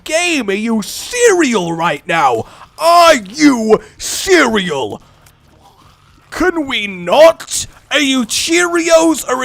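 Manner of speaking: exasperated plea. Real, not acted. Pleading with the game, dismayed.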